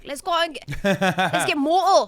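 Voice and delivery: high, slow mock Geordie girl; imitated Geordie accent. Reality show girl proposing a night out, Geordie accent. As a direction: do a Geordie Shore party girl. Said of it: "this girl would be like".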